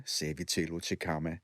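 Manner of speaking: plain reading voice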